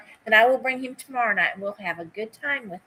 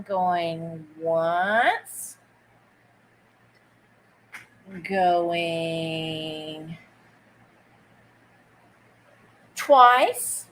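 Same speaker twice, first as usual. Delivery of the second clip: auction call; 5 semitones lower, much slower than usual. Real, not acted. Auction countdown, drawn out.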